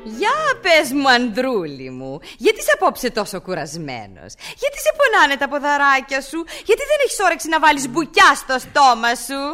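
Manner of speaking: slow, sugary mock sweetness to husband; wife sweetly mocking her tired husband; mock tender. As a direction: coo it sweetly, all false. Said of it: "στην τρυφερή τους αυτή συζυγική σκηνή".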